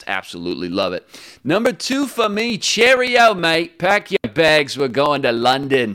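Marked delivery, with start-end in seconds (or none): 1.4-5.9 hammed-up high British accent, cheerio and all; mock Londoner, much higher and cheerier; cartoon English bloke inviting his cohost to London; bad British accent. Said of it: "That accent was horrible".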